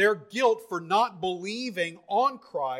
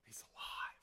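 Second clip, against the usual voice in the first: whispered awe after the climax; very soft, unvoiced pulpit hush; 20 dB quieter than usual. Real, not acted. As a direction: breathe it out in a near-silent whisper. Hushed repeat after the shout.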